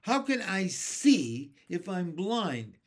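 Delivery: high, loud, drawn-out protest; slow, emphatic outraged question; indignant shout. A narrator's reading. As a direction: shout it as an exasperated earthworm. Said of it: "shouted the poor earthworm".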